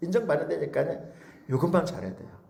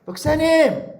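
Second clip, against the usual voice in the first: congregant calling pastor; 11 semitones higher, 4 dB louder, slower than usual. Retold or imitated. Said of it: "꼭 이런 사람이 있어요".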